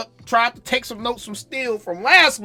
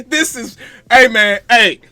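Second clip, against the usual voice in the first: ordinary delivery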